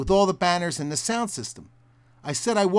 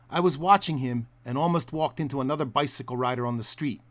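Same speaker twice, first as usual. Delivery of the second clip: ordinary talk, nothing marked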